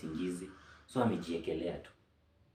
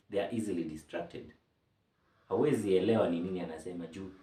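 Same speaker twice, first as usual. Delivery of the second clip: normal talking voice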